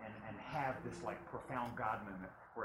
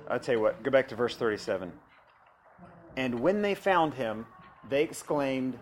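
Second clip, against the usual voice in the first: preaching at full force; 3 semitones higher, 13 dB louder than usual. Acted. Reads scripture, preaching loud.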